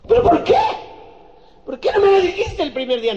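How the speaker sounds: put-on high, loud girlfriend voice demanding answers; shrill woman's demand. Girlfriend demanding why he won't marry her, high and loud. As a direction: squeal it like a wronged girlfriend.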